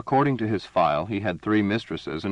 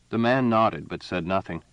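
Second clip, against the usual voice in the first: ordinary delivery, nothing marked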